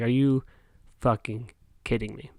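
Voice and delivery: ordinary talk, nothing marked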